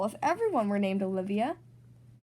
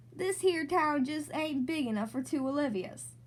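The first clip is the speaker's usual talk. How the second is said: cowboy drawl. Pretend. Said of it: "imagined that she was in the Wild West".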